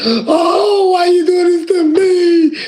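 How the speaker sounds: high, loud crying plea; Esau weeping. Retold or imitated. Esau crying out to his father, high and loud, near sobbing. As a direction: cry it out like a wronged son begging. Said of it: "Esau lifted up his voice and wept".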